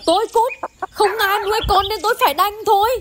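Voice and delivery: high, bitter answer back; wife retorts bitterly to husband, pitched high; defiant retort. Acted. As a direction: throw his words back at him.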